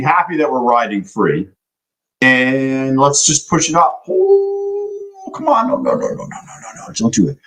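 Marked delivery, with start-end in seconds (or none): 4.1-5.7 squeaky high oh, come on; pained high-pitched groan at the chart; frustrated cry. Real, not acted. 5.7-7.4 breathless whispered string of no; whispered no's. Real, not acted. Watching trade go wrong.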